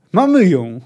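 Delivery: loud triumphant shout; eager shout. A narrator's reading. Ryan spotting the tracks, eager shout. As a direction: call it out like the prey is caught. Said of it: "krzyknął zapalczywie Ryan".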